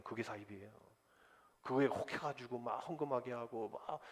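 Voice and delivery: ordinary delivery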